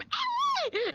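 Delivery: high calling scream of her name; high-pitched excited call across the room; imitated woman's scream. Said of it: "I hear a woman's voice scream".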